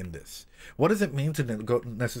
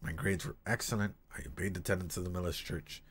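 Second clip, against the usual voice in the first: story reading; 4 semitones lower than usual. Acted. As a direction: read it gently and let it fade nearly to a whisper. Rereading the same passage.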